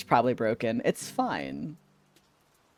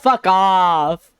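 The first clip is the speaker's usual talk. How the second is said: yelling; 4 semitones higher, 6 dB louder than usual. Real, not acted. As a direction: yell it again. Repeats shout.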